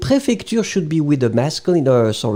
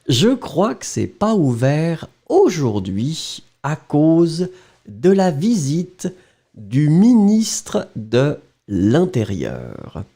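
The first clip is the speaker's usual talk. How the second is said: slow, chunked, with pauses; slow dictation pace with pauses. Shown as a demonstration. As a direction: dictate the last part very slowly. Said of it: "well, don't look at it".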